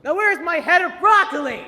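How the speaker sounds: high, loud shrieking demand; frantic yell. Acted. Teacher yelling backstage for her missing prop.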